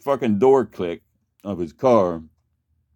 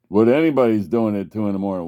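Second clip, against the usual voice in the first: normal talking voice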